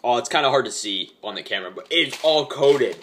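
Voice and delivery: ordinary talk, nothing marked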